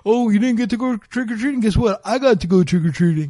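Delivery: lightly voiced bragging child; kid voice. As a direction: tease like a smug little kid. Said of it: "You're doing Just Rain's kid voice".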